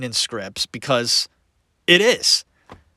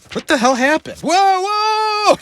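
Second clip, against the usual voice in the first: startled; slower than usual. Real, not acted. Startled by something off camera.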